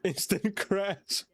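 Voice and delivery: slow words broken by laughter; laughing. Real, not acted. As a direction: laugh it out slowly. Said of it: "I shouldn't be laughing".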